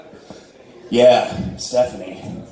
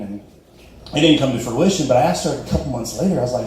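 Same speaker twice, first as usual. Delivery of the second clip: normal talking voice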